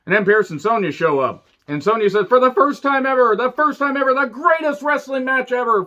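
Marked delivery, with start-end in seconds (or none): 2.3-5.8 high-pitched, overexcited announcement voice; mock hype announcer. Podcaster mocking the show official's big announcement, high and hyped. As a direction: parody an authority figure overselling the match.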